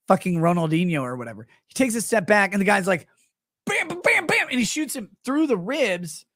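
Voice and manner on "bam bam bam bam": high, rapid-fire bam noises; cartoonish pistol shots; imitated gunshots. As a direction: mimic gunfire with a high-pitched voice.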